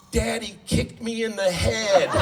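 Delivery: imitating crying toddler. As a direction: lightly play the wailing toddler tattling on daddy. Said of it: "through her tears said".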